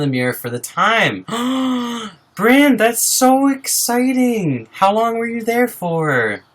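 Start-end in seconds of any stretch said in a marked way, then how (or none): 1.3-2.1 high delighted gasp; sharp happy intake of breath; excited gasp. Real, not acted. Viewer says they looked in mirror. 2.3-6.4 much higher and louder, slow and stretched; thrilled high stretched praise. Real, not acted. Viewer shares progress.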